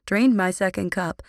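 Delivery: ordinary delivery, nothing marked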